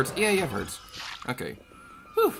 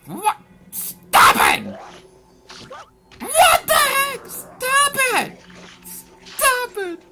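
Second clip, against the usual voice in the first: high-pitched, loud screech; exasperated high shout; frantic yelling; 9 dB louder, much slower than usual. Real, not acted. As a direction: screech in panicked outrage at the attacker. Yelling as enemy attacks.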